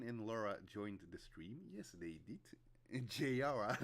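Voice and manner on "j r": loud high laughing fit; burst of laughter. Real, not acted. Streamer bursting out laughing at chat. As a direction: crack up at the chat.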